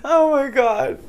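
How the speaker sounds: high, squealing through giggles; cracking up, can't hold it in; laughing. Real, not acted. Guest cracking up at intro.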